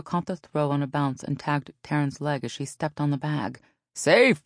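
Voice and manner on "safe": loud, high umpire ruling; emphatic ballfield yell; shouted call. A narrator's reading. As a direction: shout the call across the infield. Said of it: "Phyllis shouted".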